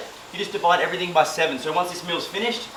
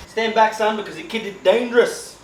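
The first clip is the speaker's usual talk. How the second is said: higher-pitched mock bravado; playful swagger; 6 semitones higher than usual. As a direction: show off like a cocky kid, jokingly. Playful boast while cooking.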